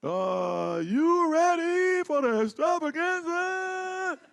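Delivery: high, slow, shouted ring announcer call; booming ring intro, hammed up; Michael Buffer fight announcer. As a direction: stretch it out like a boxing announcer. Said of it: "a full Michael Buffer like he's doing a fight".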